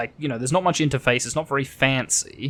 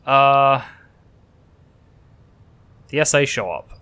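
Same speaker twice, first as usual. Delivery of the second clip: ordinary talk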